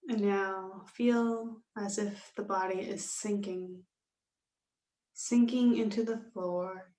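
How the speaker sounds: slow, measured yoga nidra guidance with long pauses; guided meditation. Real, not acted. Yoga teacher settling students into Shavasana, slow calm meditation voice. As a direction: ease them into stillness, unhurried and calm.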